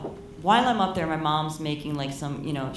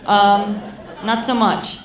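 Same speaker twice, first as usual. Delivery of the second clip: louder alarmed warning; 3 semitones higher, 6 dB louder than usual. Retold or imitated. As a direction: loudly tell her to ease off. Telling mom to slow down.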